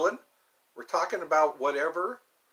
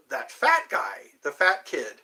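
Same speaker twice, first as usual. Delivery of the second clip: ordinary talk, nothing marked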